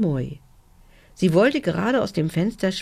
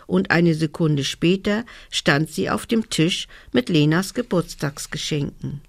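ordinary delivery, nothing marked

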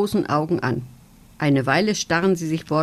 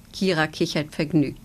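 ordinary delivery, nothing marked

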